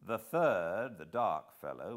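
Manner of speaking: ordinary talk, nothing marked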